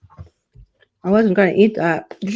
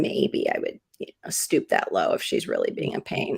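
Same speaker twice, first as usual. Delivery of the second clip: whispering; 14 semitones lower, 8 dB quieter than usual. Real, not acted. Whispered aside about her brother.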